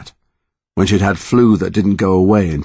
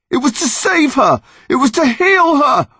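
high angry shout through tears; tearful anger; 4 dB louder than usual. Acted. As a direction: yell it, red-faced and crying. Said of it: "tears ... streaming angrily".

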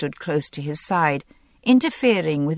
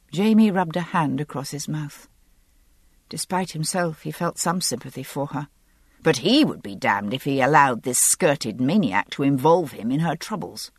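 usual speaking voice

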